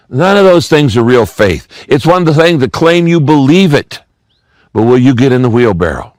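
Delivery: ordinary delivery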